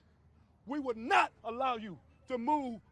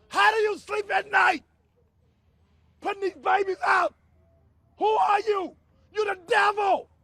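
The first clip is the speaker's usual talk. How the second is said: loud, high, furious yelling at officials; angry protester shouting down the housing authority; 5 semitones higher, 7 dB louder than usual. Real, not acted. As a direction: rage at them at the top of your lungs. Confronting housing authority at rally.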